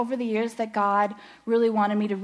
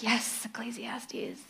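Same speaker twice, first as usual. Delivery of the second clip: slow mock whisper, drawn out; whispering Charles; 8 dB quieter, much slower than usual. A husband teasing in a slow, hushed whisper. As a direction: breathe it slowly like a smug aside. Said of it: "And Charles like".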